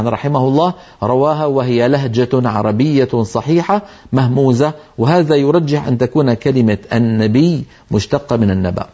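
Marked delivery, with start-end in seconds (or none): none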